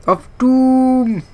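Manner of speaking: a hammed-up movie-trailer "Of doom"; dramatic doom voice. Gamer in the dark screen adds a hammed-up "of doom". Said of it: "Anything sounds more dramatic if you put of doom".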